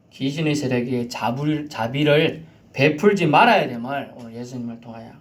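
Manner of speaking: usual speaking voice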